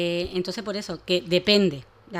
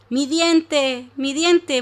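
higher, louder distressed little dragon; upset little dragon; 7 semitones higher, 7 dB louder, much slower than usual. Acted. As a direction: fret over the lost tooth. Dragon searching for lost tooth.

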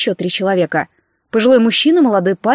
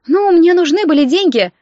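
higher-pitched, aggrieved self-justification; defensive plea, pitched up; self-pitying declaration; 6 semitones higher than usual. A narrator's reading. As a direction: justify yourself like a wronged woman. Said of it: "заявила она так, словно работа в массажном салоне".

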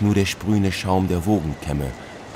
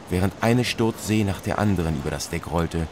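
plain reading voice

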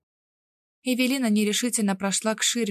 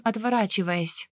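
ordinary delivery, nothing marked

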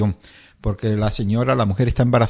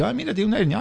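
lightly voiced doctor, higher and casual; mock doctor; 9 semitones higher, 3 dB quieter, faster than usual. Doctor telling the patient about the hernia, mildly surprised. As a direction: play the doctor finding it by chance. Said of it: "y dice".

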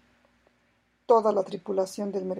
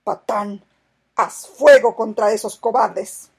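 shouted order; 12 dB louder than usual. A narrator's reading. Captain orders fire in battle.

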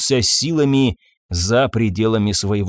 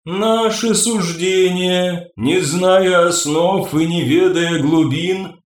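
high, slow, ringing otherworldly voice; a calm alien oracle, slow and ringing; ringing crystal chime voice; 4 semitones higher, slower than usual. Acted. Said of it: "негромкий звенящий голос".

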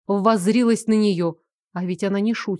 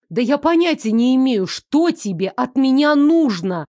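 loud, slowing indignant cry; indignant exclamation; 4 semitones higher, 4 dB louder than usual. Acted. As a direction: protest loudly, slowing at the end. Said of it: "возмущённо воскликнула я". (возмущённый возглас)

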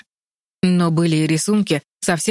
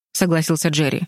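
ordinary delivery, nothing marked

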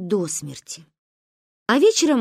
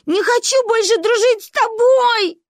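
very high, loud wail; crying; 8 dB louder than usual. A narrator's reading. Girl breaking up with her friend, crying. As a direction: wail it out in childish tears. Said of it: "заплакала Манька".

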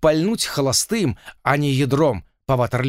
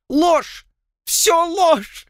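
sobbing shout, much higher and louder; angry crying; 3 dB louder, much slower than usual. A narrator's reading. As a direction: scream through tears. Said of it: "рыдая, кричал Эстебан".

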